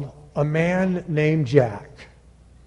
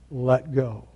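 low, soft command; calm insistence; 5 semitones lower, 9 dB quieter than usual. Acted. God urging Jack to trust and drop, calm insistence. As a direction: repeat it quietly and firmly. God repeats the instruction calmly.

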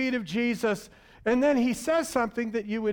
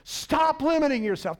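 ordinary delivery

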